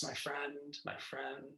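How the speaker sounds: soft, tender repetition; softly to herself. Shown as a demonstration. Speaker shows how she addressed herself, softly and tenderly. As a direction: murmur it kindly, like soothing a friend. Demonstrating kind self-address.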